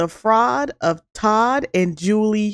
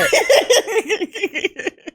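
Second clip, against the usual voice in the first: high-pitched burst of laughter; giggling fit; 13 semitones higher than usual. Real, not acted. Continues laughing.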